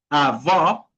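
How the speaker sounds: "avó" with an open, rising final o; outward open vowel. Shown as a demonstration. Teacher explaining the acute accent, open final vowel on "avó". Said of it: "una fuerza de voz para afuera".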